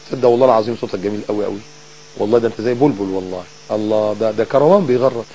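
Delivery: low, soft playful sweet-talk; coaxing flattery. Acted. Wife flattering her shouting husband, softly coaxing. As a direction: soothe him with mock-earnest compliments. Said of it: "ما تضحكي جوزك".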